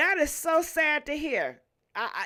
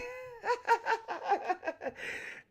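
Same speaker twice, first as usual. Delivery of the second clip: high cackling laugh; 10 semitones higher, 4 dB quieter than usual. Real, not acted. Cracks up at own joke.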